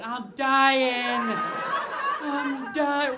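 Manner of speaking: high, loud dying wail; hammed-up panicked scream. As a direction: scream it out while running in panic. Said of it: "arms flailing, going".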